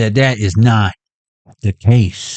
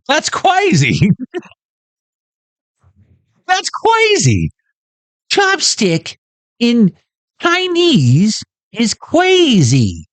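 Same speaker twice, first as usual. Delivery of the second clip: high insistent character voice; 10 semitones higher, much slower than usual. Acted.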